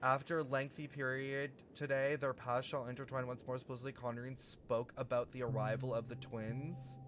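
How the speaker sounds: formal reading. Acted. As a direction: read it like an old prophecy. Reading game text aloud.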